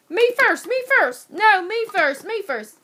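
loud, high pleading shouts; shouting over each other; clamoring shout. Acted. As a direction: beg loudly to go first. Boys scramble to be first.

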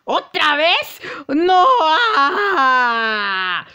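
high, loud wailing no; despairing scream. Acted. Trapped character screams at being caught again. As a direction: cry out in despair.

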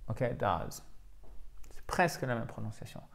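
usual speaking voice

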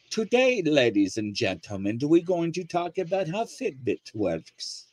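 lightly done British science presenter; British accent. Retold or imitated. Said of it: "love the accent".

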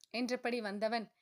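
ordinary delivery, nothing marked

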